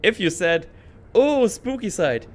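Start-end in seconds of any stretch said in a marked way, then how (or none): 1.2-2.3 high, loud, drawn-out mock dread; mock spooky. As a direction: slowly ham up a spooky answer. Acting out the viewer's answer.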